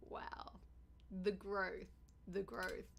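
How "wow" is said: ordinary delivery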